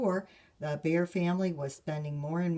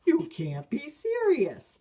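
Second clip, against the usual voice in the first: aghast papa; 9 semitones higher, 7 dB louder than usual. Acted. Papa shocked.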